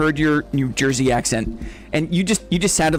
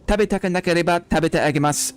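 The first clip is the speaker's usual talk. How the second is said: rapid-fire staccato Japanese; quick demo of Japanese rhythm; faster than usual. Shown as a demonstration. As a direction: rattle off the syllables quickly and evenly. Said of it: "there's a staccatic nature to it".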